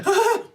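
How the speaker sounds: sudden high mock shriek; a high imitated cry. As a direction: squeal it, mocking her. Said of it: "She's like".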